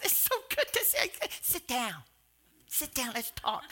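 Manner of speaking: a lightly voiced happy mother welcoming a guest; cheerful welcome. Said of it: "My mom just says".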